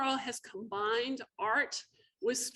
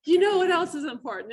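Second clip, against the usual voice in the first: laughing; 7 semitones higher, 8 dB louder than usual. Retold or imitated. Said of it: "There's a hook".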